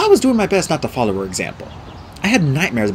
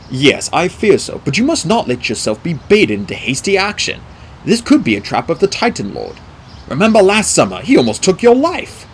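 grave mentor; 5 semitones higher, 4 dB louder than usual. A narrator's reading. Mentor urgently warns the boy.

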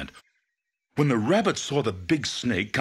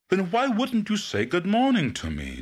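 higher, indignant question; demanding; 13 semitones higher than usual. Acted. Python confronting the iguana, indignant and demanding. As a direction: press him for an answer. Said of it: "demanded the snake".

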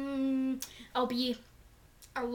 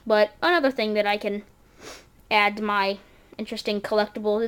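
ordinary talk, nothing marked

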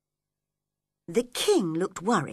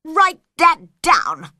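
loud, slow royal order; commanding king; 7 semitones higher, 10 dB louder, slower than usual. Acted. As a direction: ring it out, higher and drawn out.

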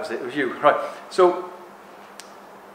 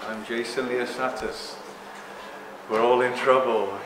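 slow, grave doom-laden mock lecture; mock serious; 3 semitones lower than usual. Speaker parodies his own serious talk, mock grave. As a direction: play the solemn prophet of doom, half joking. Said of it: "I can be here and be really serious if you want".

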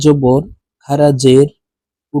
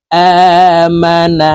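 আমানা chanted slowly with the opening আ held long; drawn-out vowel; 4 semitones higher, 4 dB louder, much slower than usual. Shown as a demonstration. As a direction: stretch the first vowel of আমানা. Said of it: "এক আলিফ টেনে পড়ব". (আমানা-র আ টেনে ধীরে পড়া)

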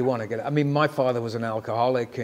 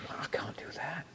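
whispered; 16 dB quieter than usual. Retold or imitated. Panicked inner thought, whispered.

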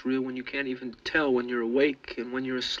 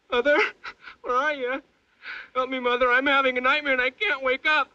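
high, sobbing terror; nightmare moaning; 14 semitones higher, 7 dB louder than usual. Acted. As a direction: wail for help, crying and desperate.

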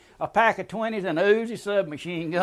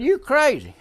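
higher, louder disbelieving cry; louder exclaim; 8 semitones higher, 8 dB louder than usual. Retold or imitated. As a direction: hit it harder the second time, in disbelief. Stunned friend after the gator scare.

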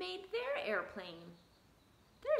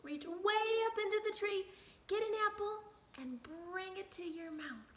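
put-on broad singsong kids theatre; 7 semitones higher than usual. Shown as a demonstration. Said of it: "we have emotion".

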